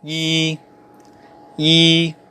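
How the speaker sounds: YI held long and level; "yi" in a flat first tone; Mandarin pronunciation. Shown as a demonstration. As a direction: sustain "yi" on one steady pitch. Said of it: "number one".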